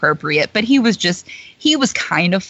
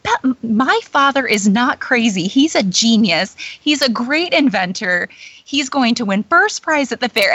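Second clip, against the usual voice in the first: higher, indignant princess defending her father; Belle; 4 semitones higher than usual. Acted. Belle lashing out at a teasing guest, indignant. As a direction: protest proudly, leaning on the praise. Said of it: "Belle's gonna lash out".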